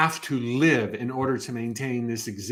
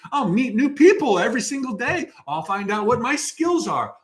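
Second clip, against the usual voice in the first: much higher, bright mock eagerness; a soul gushing about going to work; eager spirit; 8 semitones higher, 3 dB louder than usual. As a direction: sign up like a thrilled newcomer. Eager spirit choosing life.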